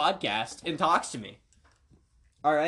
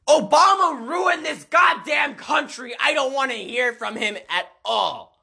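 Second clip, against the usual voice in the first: loud, high rant, drawn out; high-pitched indignant shout easing off; indignant outburst; 6 semitones higher, 5 dB louder than usual. Pretend. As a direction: erupt in mock outrage, shouting the first words. Sudden political outburst at the question.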